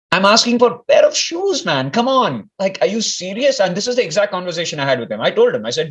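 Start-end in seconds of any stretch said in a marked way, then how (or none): 0.1-3.6 higher, pleading complaint, half joking; a teen protesting to Swami, exasperated and higher; exasperated plea. Retold or imitated. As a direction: whine to Swami that it's unfair. Complaining to Swami about shoes.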